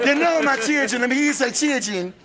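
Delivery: high-pitched preacher call-and-response; hammed-up Southern evangelist; televangelist preacher. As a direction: call on the congregation to echo you. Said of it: "a character called the Reverend Dental Virtue".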